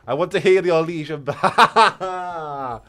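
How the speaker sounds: loud, slow, laughing plea; excited laughing call. Real, not acted. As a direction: shout it out through laughter. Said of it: "excited request, laughing".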